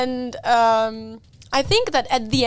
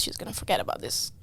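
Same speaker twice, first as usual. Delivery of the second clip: dismissive whisper; 11 semitones lower, 10 dB quieter than usual. Retold or imitated. Mom dismissing daughter's plan.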